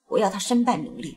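low, soft vicious threat; hiss-free cold menace; menacing. Acted.

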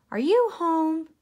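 high, loud hail through a door; calling from outside. A narrator's reading. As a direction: call out to see who's home. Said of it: "someone called outside".

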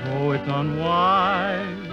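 ordinary delivery

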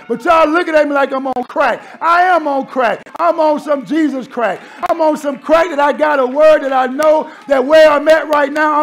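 loud, high whooping sermon; shouting the word of God to the church; fiery preaching. Real, not acted. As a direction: preach it full force to the congregation. Preaching at full force.

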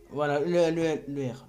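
blurting the letter out loud; startlingly loud letter; sudden loud R. Real, not acted. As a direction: shout the letter R by accident. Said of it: "pardon si j'ai fait le R comme ça".

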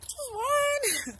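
squeaky falsetto, pleading; high mock voice. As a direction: beg for just one in a squeaky falsetto. Voicing sister at bar.

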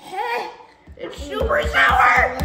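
laughing loudly, mouth puckered; laughing through sour candy. Real, not acted. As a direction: laugh it out while chewing.

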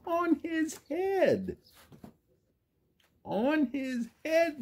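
high, slow, comic emphasis. Acted. Teacher delighting children with the bucket joke, high and slow. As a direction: squeal it out for the kids. Exaggerated repeat for kids.

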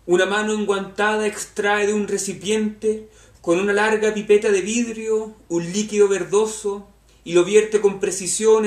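poem recitation. Acted. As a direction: recite the verses with measured projection. Reads a poem aloud.

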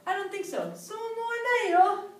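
そう思わない、と高めの声で. Shown as a demonstration. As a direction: say it up high, unconvinced. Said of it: "I doubt itは".

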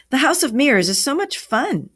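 put-on thrilled child's outburst; excited exclamation. A narrator's reading. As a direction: exclaim it with lifted, eager pitch. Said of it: "exclaimed Amy".

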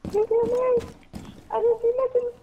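very high squeaky falsetto. Pretend. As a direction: squeak it out like a cartoon. Squeaky voice pretending innocence.

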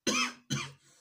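sudden high, loud laugh at himself; burst of laughter. Real, not acted. Laughing at his own coding mistake, sudden loud burst. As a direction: crack up at the silly bug.

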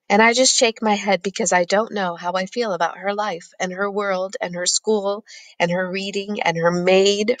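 ordinary delivery

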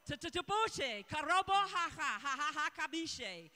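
rapid syllables of tongues; praying in tongues. Real, not acted. Pastor praying in tongues over the nation, rapid tongues. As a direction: let the tongues flow out.

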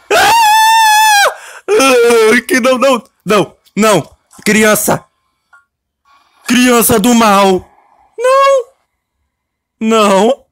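cris forts et aigus paniqués; panique criée. Real, not acted. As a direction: hurle de désespoir coincé.

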